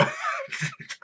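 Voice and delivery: a high, screaming burst of laughter; shrieking laugh. Real, not acted. Reviewer shrieks with laughter at Kagura ripping it off, screaming laugh. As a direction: shriek with laughter at something outrageous.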